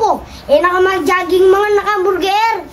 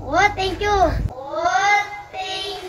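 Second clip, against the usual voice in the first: tearful; 7 dB quieter, slower than usual. Real, not acted. Gift surprise, tearful thanks.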